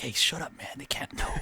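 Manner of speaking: soft, dropping into a whisper; retold hushing. Wakabayashi silencing excited members, hushed. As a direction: hush them as if keeping a secret.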